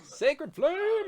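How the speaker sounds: high, hammed-up cry; echoed spell call. Game master echoing the player's spell, hammed-up call. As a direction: echo the spell name as a theatrical incantation.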